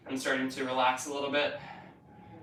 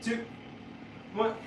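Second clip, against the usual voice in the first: doing sit-ups; 4 semitones higher, 6 dB quieter than usual. Real, not acted. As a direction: talk through the burn of ab work. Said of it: "My abs are on fire".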